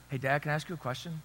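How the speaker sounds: quiet, quick and mock-solemn child's question; solemn serious voice. Son to dad in the car, mock solemn and hushed. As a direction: ask it like a son about to raise something grave. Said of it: "the most solemn, serious voice ever".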